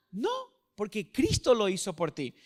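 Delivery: ordinary talk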